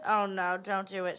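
ordinary delivery

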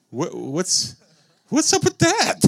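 squeaky high, disbelieving question; hammed-up disbelief from the pulpit; mock incredulity. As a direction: act stunned at the idea.

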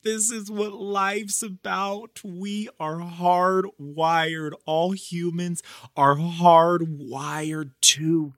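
high, drawn-out wonder; heartfelt gushing. Real, not acted. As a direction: marvel at it, stretching the key words. Gushing about friendship and connection.

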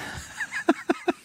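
very high burst of laughter; squealing laugh. Real, not acted. Host shrieking with laughter at the ranking.

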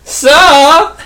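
high, slow mock German villain voice; hammed-up German accent over a loudspeaker. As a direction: taunt the players in a hammy German accent. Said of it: "What accent are you doing there".

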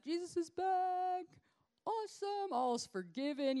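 high, loud and drawn-out cheer; overjoyed shout. Acted. Disciples cheering that Jesus is back, loud and high. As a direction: whoop it out like a thrilled fan.

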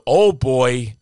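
put-on loud, broad Wisconsin "oh, boy"; Wisconsin accent. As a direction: exclaim it as a Wisconsin woman. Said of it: "she says with her".

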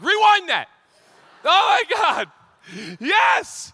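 high, loud whooping delight; excited yelling. Retold or imitated. Dad telling his kids to rewind the ad, excited shouting. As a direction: shout it like a thrilled fan. Reliving excited rewatching of commercial.

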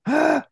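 high, gasping ah of alarm; a sharp gasped ah at bad news; gasp of dismay. Driver caught with a DWI, a high mock gasp.

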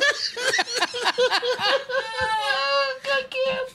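high, helpless cackle; big laughing fit. Real, not acted. Storyteller cracking up at his own pretzel detail, laughing fit. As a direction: crack up in long, high-pitched peals.

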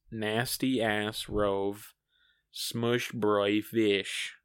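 "nasty ass rove, smush broy fish" drawn out very slowly; backwards speech. Shown as a demonstration.

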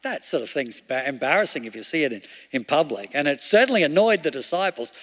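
usual speaking voice

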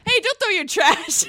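yelling. Retold or imitated. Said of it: "someone's like".

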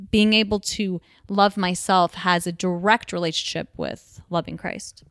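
normal talking voice

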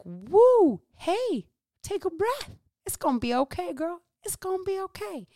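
high, stretched-out coaxing call; calming coach. Acted. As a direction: reel someone in with a high, sing-song reassurance. Imagined soothing call to client.

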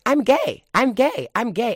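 high, loud, bouncy announcing; gleeful child. Retold or imitated. Child happily announcing all day.

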